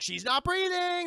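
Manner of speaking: loud, high, slow "She's not breathing"; a hammed-up panicked cry; alarmed cry. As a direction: raise the alarm. Soldiers alarmed.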